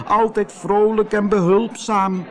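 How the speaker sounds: high, acted tearful wail; sobbing. Hansje mourns lost friend, high acted sobbing. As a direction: sob it out in a high little voice. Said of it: "snikte Hansje".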